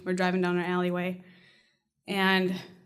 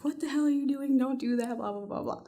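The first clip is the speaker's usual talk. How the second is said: muttered protest trailing into blahs; retold muttering; 3 semitones higher, 6 dB quieter than usual. Husband objecting as she walks down the alleyway, muttering. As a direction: grumble it softly, fading away. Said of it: "he's still muttering".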